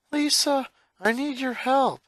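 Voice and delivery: put-on a kid brother asking for help; young boy voice.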